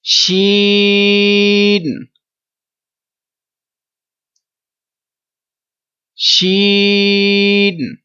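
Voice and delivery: teacher chanting long शीन; drawn-out recitation. Shown as a demonstration. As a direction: stretch शीन evenly. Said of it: "तीन second लंबा भी करना है".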